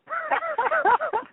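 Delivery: short, loud, high-pitched laugh; real laughter. Real, not acted. Comic laughing after the trainer bit, high and loud.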